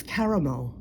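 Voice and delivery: "caramel" as ka-ra-mel; caramel in three syllables. Shown as a demonstration. Correcting American friends, "caramel" with three syllables. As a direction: sound the middle syllable of "caramel". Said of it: "There's a separate syllable".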